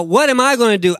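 ordinary talk, nothing marked

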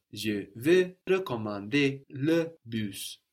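Je vais recommander le bus, slow and careful with a plain E; slow model sentence. Shown as a demonstration. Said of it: "so it's like je".

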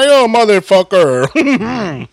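high, loud, drawn-out exclamation; rowdy shout. Acted. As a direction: shout it out like a rowdy party toast. Mock outburst in Spanish accent.

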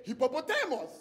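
"hippopotamus" in a Ghanaian accent, stressed "TEH-mos" ending; Ghanaian pronunciation. Shown as a demonstration. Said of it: "I say".

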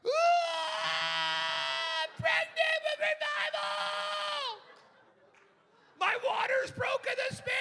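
very high, loud, slow hammed-up scream of a man in labor; high mock birthing scream; wild labor shout. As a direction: wail like a grown man giving birth. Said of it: "these men by them are like".

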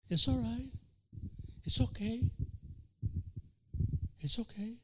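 tempting devil. Acted. Satan soothing a sinner into temptation, soft coaxing. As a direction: coax like a sweet-talking tempter. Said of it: "Satan tempting softly".

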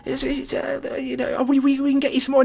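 high, soft, mock-smooth charm; suave drunk boyfriend. Drunk boyfriend smoothly soothing his angry girlfriend. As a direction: play the drunk boyfriend trying to sound suave. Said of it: "trying to be all suave about it".

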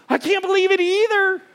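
high, excited agreement; amazed. Retold or imitated. Josh answering his friend, high with excitement. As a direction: share the wonder back. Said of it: "He goes".

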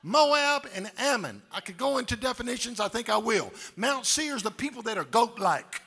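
loud, rising, chanted preaching; full-force preaching. Acted. Preacher rallying the congregation, loud chanted preaching. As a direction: build them up and shout it home.

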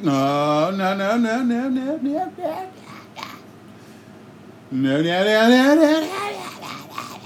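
rising sing-song no, no, no; teasing no no no. Pretend. Sister teasing him in the car, sing-song denial.

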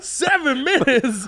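high, drawn-out mock outburst; retold meltdown. Comic retelling his Uber meltdown to the host, exasperated.

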